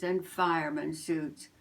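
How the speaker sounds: plain reading voice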